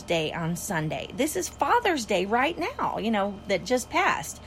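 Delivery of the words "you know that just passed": ordinary talk, nothing marked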